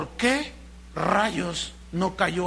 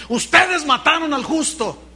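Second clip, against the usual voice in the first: accusing quote; 6 semitones higher, 4 dB louder than usual. Retold or imitated. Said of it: "¿Por qué Esteban les dijo".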